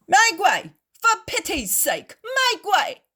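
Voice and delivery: loud, high calls to clear the way; shouting. Acted. Litter bearers push through the crowd, shouting. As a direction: bellow at the crowd to part. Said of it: "shout some bearers of a litter".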